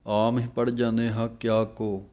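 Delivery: usual speaking voice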